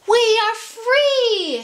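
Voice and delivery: high, slow, ringing shout of joy; joyful cry. Acted. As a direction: proclaim freedom triumphantly. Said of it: "said Louise to the other chickens".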